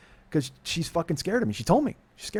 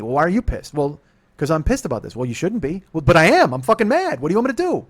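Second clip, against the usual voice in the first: louder, higher, heated back and forth; acted quarrel; 4 semitones higher, 7 dB louder than usual. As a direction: replay the argument, heated.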